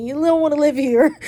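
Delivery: half-laughing emotional outburst; getting emotional. Retold or imitated. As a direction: voice rising as tears come. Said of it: "So I'm getting emotional".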